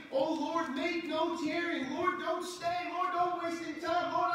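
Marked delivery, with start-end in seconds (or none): 0.1-1.9 high, loud, slow "make no tarrying" pleading; urgent cry. Acted. Preacher leading the church to cry out loud. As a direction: beg the Lord to hurry, full voice.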